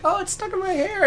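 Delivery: high, panicky squeal of a woman freaking out; the lady freaking out about the tarantula, mock panicked squeal. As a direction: shriek it like the hair is caught. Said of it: "freaking out".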